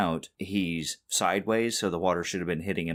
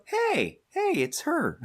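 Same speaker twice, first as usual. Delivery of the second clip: sudden high squeal of recognition; giddy high "hey, it's her"; excited recognition; slower than usual. Retold or imitated. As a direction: pitch way up, delighted. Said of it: "And go".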